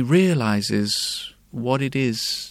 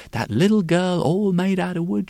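usual speaking voice

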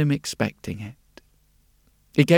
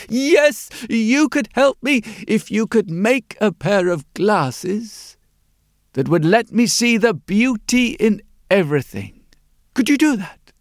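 eager mole-like man; 5 semitones higher than usual. A narrator's reading. Mole-like man begging for special glasses, eagerly pleading. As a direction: plead with rising hope, pitched up.

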